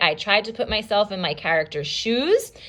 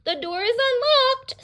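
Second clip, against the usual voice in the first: put-on much higher old-lady voice; granny voice. Granny answers the visitor in a high old-lady voice. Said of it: "said Granny".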